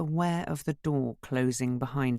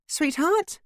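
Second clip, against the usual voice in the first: calling out; 4 dB louder than usual. A narrator's reading. Narrator voices mother calling.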